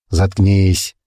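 ordinary delivery, nothing marked

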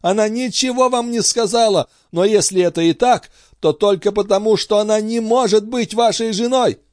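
high-pitched, vehement denial; impassioned cry. A narrator's reading. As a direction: cry it out in anguish. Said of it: "вскричала она". (страстный высокий крик)

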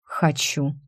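low, quietly resolute; firm declaration. A narrator's reading. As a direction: state it firmly without raising the voice. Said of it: "он твёрдо заявил". (негромко, но твёрдо)

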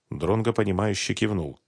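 usual speaking voice

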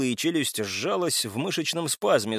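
ordinary delivery

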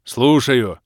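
loud, short roar; roared reply. A narrator's reading. Scarlet Bars answering the baroness's call, roaring. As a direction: bellow it like a soldier reporting. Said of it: "проревел алый барс".

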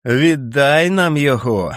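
a hint of a monster howling in laughter; howling jeer. A narrator's reading. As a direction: howl it like a cackling beast. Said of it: "крикнув іще один голос, сповнений реготу і виття".

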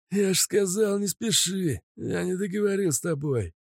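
high, sneering bandit voice; menacing thug voice. Acted. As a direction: threaten with a gun, jeering. Mazut threatening Kostya.

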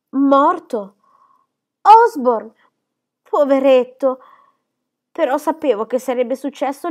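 loud, high, self-absorbed fluster; fussy exclamation. Acted. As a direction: Mrs Gibson reacting to news of Osborne's death, loud and flustered. Mrs Gibson reacts, shrill and fussy.

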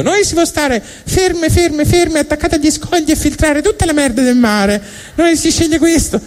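a chirpy mussel proudly choosing its fate. Acted. Mussel telling God its choice, very high chirpy voice. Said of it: "la cozza che ha detto".

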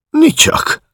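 usual speaking voice

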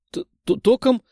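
high startled stutter; scared stammer. A narrator's reading. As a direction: stammer it out in fright. Scared stammer before shock.

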